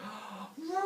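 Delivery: high gasp of wonder; hammed-up excited intake of breath. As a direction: awestruck gasp. Humans amazed.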